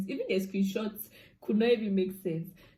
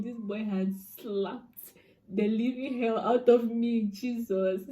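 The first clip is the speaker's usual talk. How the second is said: normal talking voice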